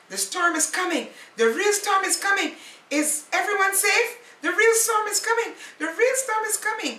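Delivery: loud, rising urgent calls, speeding up; urgent warning. Acted. Paddy rushes down the cliff calling out, loud and urgent. Paddy warns his friends.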